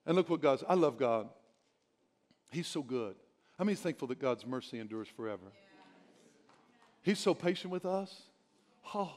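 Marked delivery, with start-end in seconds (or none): none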